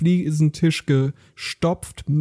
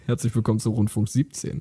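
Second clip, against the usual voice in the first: laughing welcome; 6 semitones lower, 4 dB quieter than usual. Acted. Co-host joins the greeting, laughing. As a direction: lower voice, laughing through the welcome. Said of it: "opening the show".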